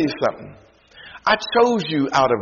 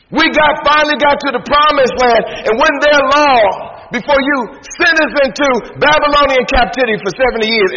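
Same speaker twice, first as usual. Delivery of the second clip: raised, indignant questions to God; voicing Israel's complaint; 10 semitones higher, 6 dB louder than usual. Preacher voicing Israel's complaint to God, indignant. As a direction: preach Israel's grievance with mock outrage.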